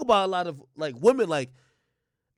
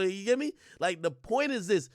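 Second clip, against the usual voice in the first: ordinary delivery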